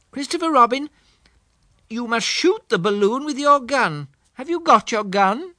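high, earnest little bear voice; a thoughtful teddy bear making a decision; Pooh voice. Acted. Pooh voice in the story.

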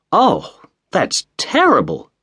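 high shocked exclamation; cried out. A narrator's reading. Mrs Otis shocked at the bloodstain, high cry. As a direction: gasp it out in shock. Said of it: "cried Missus Otis".

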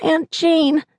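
put-on high drawn-out little boy plea; sleepy child voice. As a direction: stretch out Aunt Jane like a tired kid. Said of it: "Cooper's sleepy voice pleaded".

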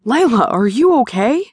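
lightly voiced worried sparring partner; a hint of Shane's concern. A narrator's reading. Shane worried over fallen partner.

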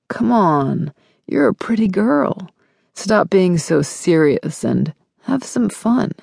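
patronizing, coaxing male character voice; smug guy urging her to loosen up; cajoling boyfriend. A narrator's reading. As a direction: coax her as the pushy boyfriend.

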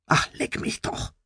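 low, unvoiced grumble under the breath; grumbled curse. A narrator's reading. Nelson grumbles at his talking computer, unvoiced and soft. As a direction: mutter it off at the machine. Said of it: "brummt Nelson".

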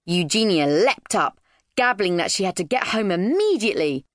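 plain reading voice

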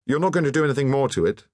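fast, high, blurted question; involuntary blurt. A narrator's reading. Alain to the painter about her work, involuntary blurt. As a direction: let it slip out in a rush. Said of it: "said Alain involuntarily".

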